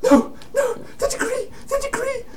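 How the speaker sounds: tiny, very high falsetto whimper; mock-panicked squeaking over a torn paper; desperate squeal. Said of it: "desperately grabbing the piece of paper".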